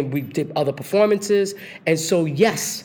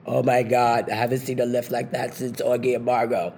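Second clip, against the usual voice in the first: a lower, lightly voiced impression of Chita's deep voice; 4 semitones lower than usual. Dancer imitating Chita's deep voice praising the lift, lower. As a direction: drop into Chita's deep admiring tone. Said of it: "I hear this deep voice".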